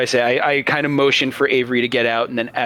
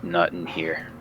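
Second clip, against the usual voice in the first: grim refusal; 7 semitones lower, 8 dB quieter than usual. Acted. Character's grim low refusal.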